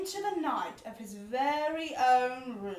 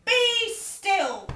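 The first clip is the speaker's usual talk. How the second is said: loud, high command to be still; taming wild things with a loud high order; 8 semitones higher, 7 dB louder than usual. A narrator's reading. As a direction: order the monsters to freeze. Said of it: "till Max said".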